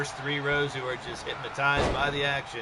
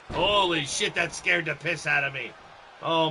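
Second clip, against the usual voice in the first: normal talking voice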